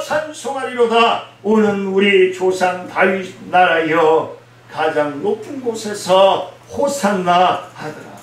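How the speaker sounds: slow, loud, lifted scripture proclamation; proclaimed scripture reading. Acted. Preacher reading the Palm Sunday text aloud to his congregation, slow loud proclamation. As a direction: ring out each Hosanna line. Reads scripture at full force.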